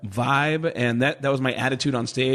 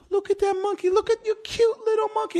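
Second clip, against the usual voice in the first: put-on much higher, cooing little old lady; faster than usual. Comic imitating an old lady cooing at the monkey, very high voice.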